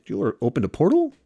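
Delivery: mock-incredulous wizard voice, rising; Doctor Strange disbelief. Doctor Strange questioning Ned about the portal, incredulous. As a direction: act out a haughty sorcerer's doubt. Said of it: "And Doctor Strange's like".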